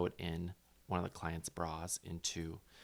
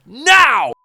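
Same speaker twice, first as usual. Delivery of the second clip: very loud, high slow yell; screaming for help; 14 semitones higher, 22 dB louder, slower than usual. Retold or imitated. Bleeding finger, yelling for a medic. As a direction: shout across the workroom for a bandage. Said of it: "And I scream".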